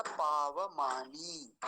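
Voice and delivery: पावमानी drawn out very slowly as pa-va-ma-ni; slow demo of पावमानी; slow careful pronunciation. Shown as a demonstration. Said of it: "वकार को पूरा ही रखेंगे हम".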